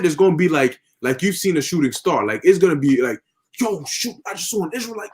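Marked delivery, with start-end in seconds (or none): 3.6-5.1 a soft, awed gasp of a line; hushed awe. Retold or imitated. Bystander spotting an Israelite, hushed awe. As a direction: breathe it out like you've seen a star.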